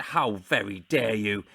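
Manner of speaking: slow, low mock indignation; Ken Dodd. Offended comedian rebuking his support act, mock indignant. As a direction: imitate an affronted comic, drawn out. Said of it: "then Ken looked at me, he said".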